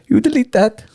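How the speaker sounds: woman's voice. Retold or imitated. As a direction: play the caught wife ordering him. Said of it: "she turns around".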